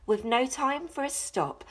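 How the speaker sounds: usual speaking voice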